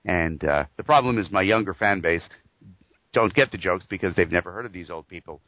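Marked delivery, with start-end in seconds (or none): none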